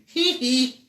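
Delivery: high, loud braying hee hee; squeaky donkey giggle; donkey hee-haw laugh. A narrator's reading. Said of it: "it sounded like he was laughing".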